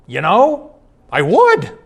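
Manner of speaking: high, loud, rising delight; dying husband finally agrees, thrilled and loud; joyful yes. Retold or imitated. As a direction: let his yes leap up in surprise. Dying man accepts, surprising all.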